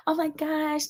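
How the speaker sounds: fake sweet gush. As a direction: gush like a phony fan. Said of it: "they try to dress it up to me".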